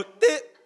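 loud, high imitated toot; high short honk; short horn toot. Shown as a demonstration. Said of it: "You don't just go".

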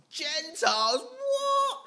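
high, loud outraged disbelief at the word; shocked disbelief. Acted. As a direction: gasp it out as if scandalised.

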